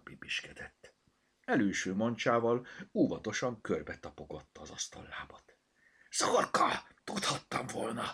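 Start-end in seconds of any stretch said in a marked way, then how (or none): 6.1-8.0 high, hissed scolding; hissing reproach. A narrator's reading. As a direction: scold through a tight hiss. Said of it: "sziszegte Bogi". (szemrehányó sziszegés)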